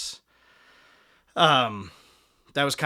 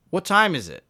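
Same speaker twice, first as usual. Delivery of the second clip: called question across the street, louder and higher; calling across; 9 semitones higher, 10 dB louder than usual. Retold or imitated. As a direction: shout the question over the traffic. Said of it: "and he goes".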